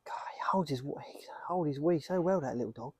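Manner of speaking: hushed whisper, half to himself; whispered aside. Real, not acted. Owner whispering while waiting for the dog. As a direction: breathe it out under your breath.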